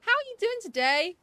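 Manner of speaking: high, loud and bright welcome; excited greeting. Real, not acted. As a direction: greet him like a beloved pet.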